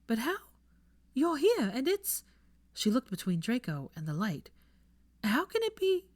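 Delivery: high confused questions; bewildered. Acted. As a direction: ask in rising disbelief.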